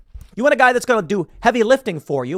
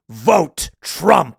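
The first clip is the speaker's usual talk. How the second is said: macho hero punchline; 4 dB louder than usual. Retold or imitated. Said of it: "turns around and goes".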